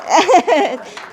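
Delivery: short nervous laugh, high and loud. Real, not acted. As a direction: let out a jittery laugh. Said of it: "I'm a little nervous".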